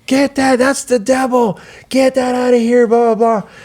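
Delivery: high-pitched frantic mock yell at her son; shrieking that the bike is the devil, high and strained; mom yelling. Mother yelling at son to get rid of stolen bike, high mock shriek. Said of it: "she starts yelling".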